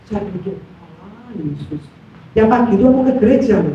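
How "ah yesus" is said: low, soft dismissive inner remark; disappointed. Retold or imitated. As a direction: let it drop quietly, unimpressed. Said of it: "Sejujurnya saya kecewa". (gumaman kecewa, pelan dan rendah)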